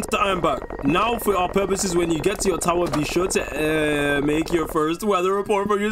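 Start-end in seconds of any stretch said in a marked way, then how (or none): none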